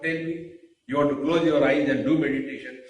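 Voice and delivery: ordinary delivery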